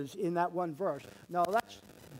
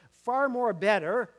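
FAR rising, loud and slow: "Far more better"; stressed phrase; 5 semitones higher, 9 dB louder, slower than usual. Shown as a demonstration. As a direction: lean hard on "Far" and draw out "Far more better". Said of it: "It says".

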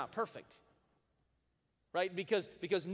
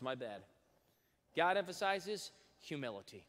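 ordinary talk

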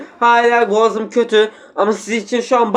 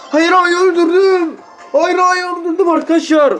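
much higher, gleeful cry of victory; excited squeal; 9 semitones higher than usual. Real, not acted. Gamer to viewers after a kill, high gleeful squeal. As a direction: squeal it out like you just won.

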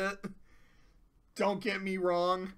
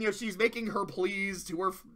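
ordinary talk

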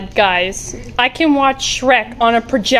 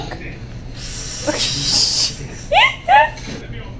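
high breathy giggle; real giggle. Real, not acted. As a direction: giggle at mom. Teasing mom about being shook.

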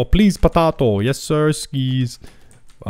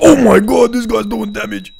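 much higher and louder, caught off guard; shocked outburst; 9 semitones higher, 4 dB louder than usual. Real, not acted. Gamer shocked as the enemy player hits back, surprised shout. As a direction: blurt it out as the fight turns on you. Enemy suddenly hurting him.

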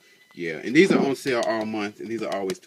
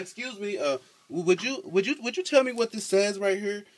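much higher, sugary polite voice asking a favour; overly polite ask; 9 semitones higher than usual. Retold or imitated. Asking passing girls to read the sign, high sweet politeness. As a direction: put on your sweetest asking-a-stranger voice.

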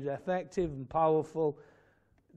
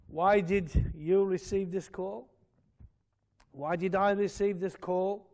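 ordinary talk, nothing marked

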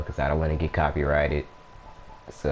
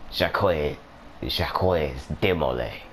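French accent. Shown as a demonstration. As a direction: say Jacques de Molay like a hammed-up Frenchman. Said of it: "It's way better in French, or the French accent".

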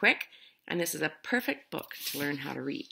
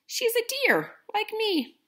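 much higher, sing-song deer; a high-pitched storybook deer; 3 dB louder, slower than usual. Acted. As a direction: pitch the deer way up, sure of herself. Deer voiced in read story.

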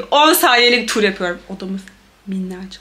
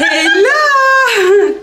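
high, loud startled cry; surprised shout; 11 semitones higher, 5 dB louder than usual. Real, not acted. Startled shout at the two-way bathroom door.

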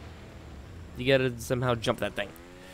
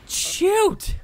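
sharp frustrated yell; 6 dB louder than usual. Real, not acted. Failing a jump.

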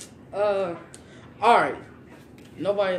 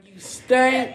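mock taunt; 6 semitones higher, 10 dB louder than usual. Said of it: "he was like".

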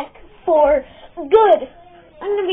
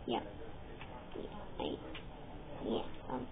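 ordinary talk

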